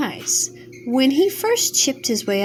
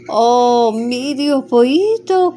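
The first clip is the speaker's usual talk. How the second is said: a louder cry from a dying fire; 2 semitones higher, 5 dB louder, slower than usual. Acted. The dying fire calling to the half chick, loud. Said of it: "cried the fire".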